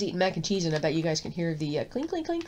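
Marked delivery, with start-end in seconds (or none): 1.8-2.5 high, quick clinks of spoon on bowl; imitated clinking. Mimicking the eating noise for viewers, high clinks. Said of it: "clink of the bowl and the glass".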